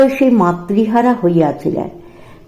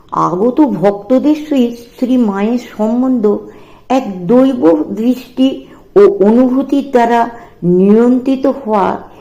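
ordinary delivery, nothing marked

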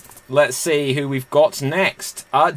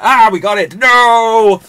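loud, raised pretend triumph turning to dismay; hammed-up big finish; mock dramatic cry; 7 semitones higher, 9 dB louder than usual. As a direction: shout it like a film climax. Acts film climax.

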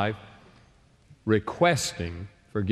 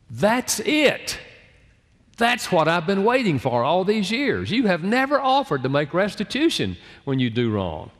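higher and louder, a wife who finally got her point; wife's triumphant retort; 8 semitones higher, 4 dB louder than usual. Retold or imitated. Wife finally telling her husband what she waited for, high and loud. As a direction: voice her like she's been waiting years to say this. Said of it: "and my wife said to me".

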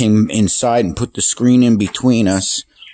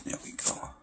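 ordinary talk, nothing marked

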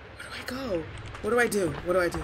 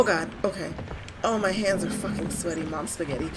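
ordinary talk

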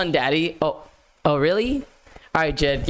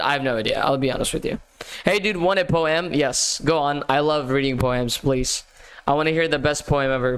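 normal talking voice

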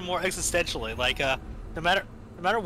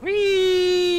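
gleeful whee; 13 semitones higher, 8 dB louder than usual. Real, not acted. Flying off on a push.

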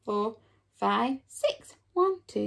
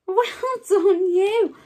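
excited praise; 7 semitones higher, 8 dB louder, slower than usual. Real, not acted. Praising after a hard word.

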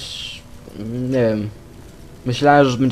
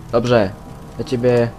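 normal talking voice

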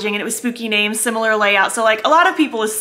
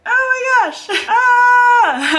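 repeated squeals climbing higher, ending in a scream; freaking out; 10 semitones higher, 3 dB louder, slower than usual. Real, not acted. Vlogger as the launch goes live, freaking out. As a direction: build from excited to a high piercing squeal. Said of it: "I'm freaking out".

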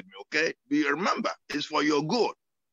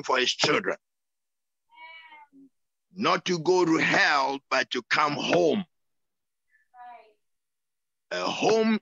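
preaching. Acted. Pastor preaching home to his flock.

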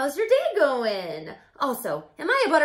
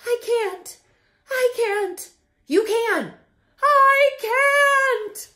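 escalating self-argument; 7 semitones higher, 6 dB louder, slower than usual. Acted. Arguing with himself.

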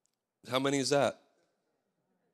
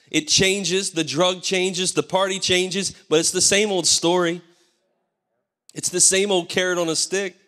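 raised, louder fervent preaching; preaching at full force; 6 semitones higher, 4 dB louder than usual. Acted. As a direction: drive it home like a pulpit preacher. Opening of sermon at full force.

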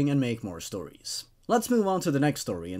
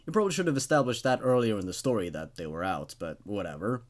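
plain reading voice

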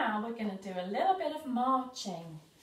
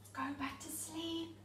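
soft whisper; 5 semitones higher, 10 dB quieter than usual. Real, not acted. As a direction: whisper them back to sleep. Hushing kids back to sleep.

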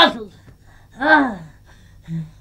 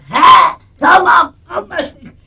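mock deal; 7 semitones higher, 9 dB louder, slower than usual. Striking deal with cat.

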